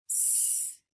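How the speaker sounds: "s-p-o-i-l" as separate sounds with gaps; tapping out sounds. Shown as a demonstration. Teacher repeating the tapped word, sound by sound. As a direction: hiss the s, pop the p, then "oi" and "l" apart. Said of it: "let's tap that out".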